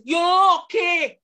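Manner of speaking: put-on slow loud Jamaican accent quote. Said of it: "As Jamaican says".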